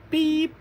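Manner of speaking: sharp, high, loud beep noise; high mock remote beep. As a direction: squeak out a remote control beep. Imitating remote control beep.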